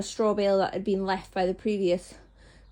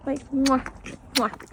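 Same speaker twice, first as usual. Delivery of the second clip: two smacking kiss sounds; goodnight kiss; 4 semitones higher, slower than usual. Real, not acted. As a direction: blow kisses to the horses. Kissing horses goodnight.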